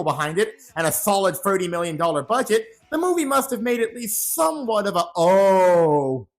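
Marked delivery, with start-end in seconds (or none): none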